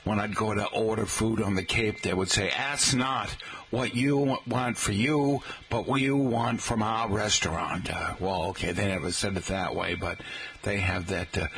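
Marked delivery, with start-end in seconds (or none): 2.5-7.9 mock Kennedy-style Boston oratory; hammed-up Kennedy accent. Cape food server imagined as Kennedy, Kennedy accent. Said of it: "had that Kennedy accent".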